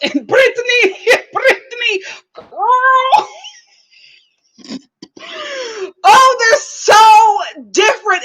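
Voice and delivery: loud, high talk through laughter; breaking up mid-sentence; laughing hard. Real, not acted. The vlogger recounts the couple while laughing, loud and high.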